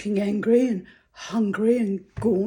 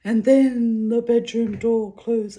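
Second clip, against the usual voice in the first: mildly hammed-up storyteller, higher and louder; mock melodrama; 4 semitones higher, 6 dB louder than usual. Teasing a sappy romance's ending, mock melodrama. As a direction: play up the romance cliffhanger a little.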